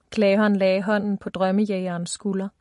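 ordinary delivery, nothing marked

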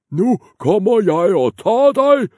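loud, very high troll bawl; troll bawling at the biggest goat. Acted. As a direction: bawl the threat. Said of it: "skrålede trolden".

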